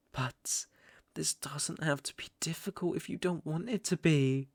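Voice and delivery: an intimate, coaxing whisper; a soft, menacing whisper. Acted. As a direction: lean in and whisper it in her ear. Villain soothes captive intimately.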